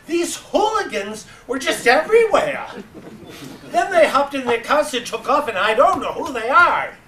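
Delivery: put-on high, loud, laughing old lady voice; an elderly woman laughing, bursting with excitement; excited old woman. Old woman laughing about kids piling wood in her yard.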